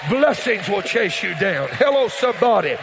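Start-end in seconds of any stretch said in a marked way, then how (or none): 1.7-2.7 loud, high, rising preacher call; full-force preaching. Acted. Pastor releasing a blessing over the church, loud preaching. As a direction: lift the house with it.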